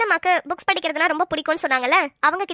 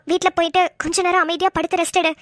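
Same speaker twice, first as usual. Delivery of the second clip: ordinary talk, nothing marked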